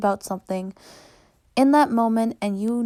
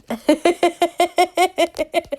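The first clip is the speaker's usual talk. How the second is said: giggly laugh; 8 semitones higher, 5 dB louder than usual. Real, not acted. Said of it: "in a silly, goofy, happy".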